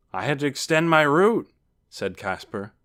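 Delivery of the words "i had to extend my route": loud, raised character voice; hearty traveller. A narrator's reading. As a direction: explain it boisterously to a little brother. Returned traveller explains himself.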